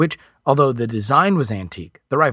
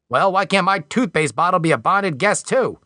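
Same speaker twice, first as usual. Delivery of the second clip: sarcastic whine; 5 semitones higher than usual. A narrator's reading. Alan sarcastic at customs official.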